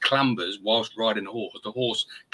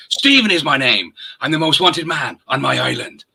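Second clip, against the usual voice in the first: loud, higher, hammed-up Irish accent; Irishman impression; 7 semitones higher, 9 dB louder than usual. [name] quoting Stephen from Braveheart, in a loud hammed-up Irish accent. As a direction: boom the Irishman's line in a big Irish brogue. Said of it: "That's supposed to be the Irishman".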